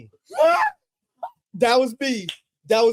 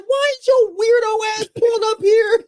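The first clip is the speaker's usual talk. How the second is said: mock teacher; 12 semitones higher than usual. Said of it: "She was like".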